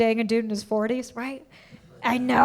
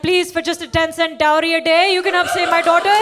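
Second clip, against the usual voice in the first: charity ad voice; 3 semitones higher, 6 dB louder than usual. Retold or imitated. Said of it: "She's like".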